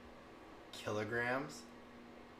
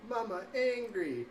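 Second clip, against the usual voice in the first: put-on much higher and slow, a little kid's singsong; toddler voice; 11 semitones higher, slower than usual. Mom imitating her son at bedtime, slow high toddler voice. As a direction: drawl it out like a sleepy toddler. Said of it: "said it right to bed".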